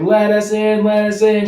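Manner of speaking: high, loud mock yelling; retold urgent plea. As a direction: yell to be let in, imitating panicked kids. Kids begging at the door, retold.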